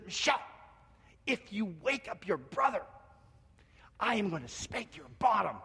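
a hint of a threatening father; stern dad. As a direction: lightly imitate dad laying down a warning. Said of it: "My dad then says words that trigger".

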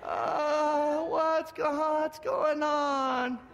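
high, drawn-out mock groggy whine; childhood self waking up. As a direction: play a kid making a show of waking up. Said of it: "I rolled over and went".

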